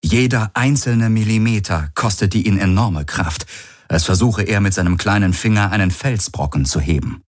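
usual speaking voice

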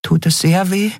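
high, small fairy voice, asking gently; careful question. A narrator's reading. Nelen asks the wounded lion-man carefully, high and gentle. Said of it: "fragte Nelen vorsichtig".

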